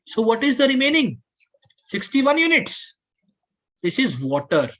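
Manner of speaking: normal talking voice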